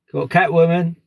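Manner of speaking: normal talking voice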